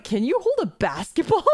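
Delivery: retold question. Streamer quoting the tall guy, high and giggly. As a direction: ask it through giggles. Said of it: "he was like".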